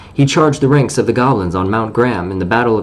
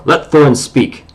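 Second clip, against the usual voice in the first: stern wizard command; 4 semitones higher, 4 dB louder than usual. Acted.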